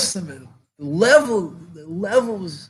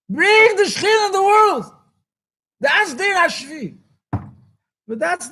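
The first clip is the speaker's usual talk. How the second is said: impassioned preaching; 8 semitones higher than usual. Real, not acted.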